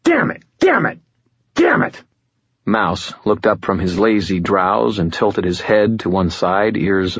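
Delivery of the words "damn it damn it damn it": high, repeated bursts of frustration; frustrated scream. A narrator's reading. Man cursing the ringing phone, frustrated scream. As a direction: fire off each curse at a high pitch. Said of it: "I screamed in sudden frustration".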